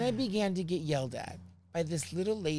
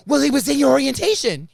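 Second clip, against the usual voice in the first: higher, louder scolding; smug clerk; 8 semitones higher, 10 dB louder than usual. Retold or imitated. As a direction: talk down like a know-it-all. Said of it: "she goes".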